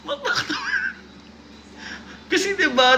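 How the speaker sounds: high-pitched, laughing protest; words breaking into laughter. Real, not acted. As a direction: crack up while questioning the line. Reader cracks up at the line.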